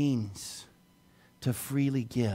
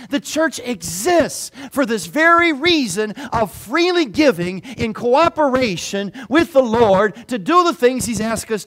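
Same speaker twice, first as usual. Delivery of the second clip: louder, higher preacher push; full-force preaching; 8 semitones higher, 9 dB louder than usual. Acted. Pastor tells the church why it exists, preaching hard. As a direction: build each phrase to a peak.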